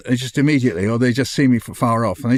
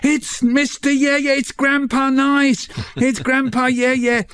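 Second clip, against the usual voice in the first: much higher, slow excited calls; excited students; 12 semitones higher, slower than usual. Retold or imitated. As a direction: squeal it like thrilled fans. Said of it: "rush towards me ... going".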